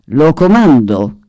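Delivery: plain reading voice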